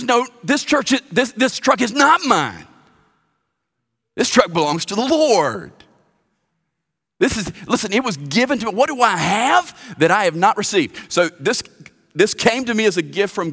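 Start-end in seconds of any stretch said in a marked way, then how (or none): 0.0-11.1 high, loud emphatic voicing of a steward; voice of stewardship. Preacher voices a steward defending his truck, high and loud. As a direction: proclaim it like a grateful steward. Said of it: "stewardship says".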